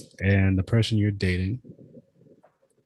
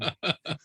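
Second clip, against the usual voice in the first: short high laugh; 8 semitones higher, 4 dB quieter than usual. Real, not acted. Guest laughs after spacing out.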